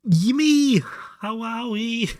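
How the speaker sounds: high, loud cheerful hello; excited greeting. Real, not acted. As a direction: call out happily. Calling greeting to a friend.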